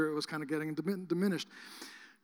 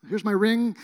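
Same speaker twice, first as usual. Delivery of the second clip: mock panic; 3 semitones higher, 9 dB louder than usual. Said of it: "I'm going like".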